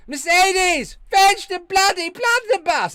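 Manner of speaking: very high, loud, plummy shout; mock posh outrage. Streamer answering a new channel member, mock-posh shout for a servant. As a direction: bellow like an outraged aristocrat calling his maid.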